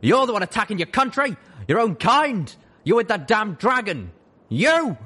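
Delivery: loud, high, furious yelling; raging. Acted. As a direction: rage at your son. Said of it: "And then he raged".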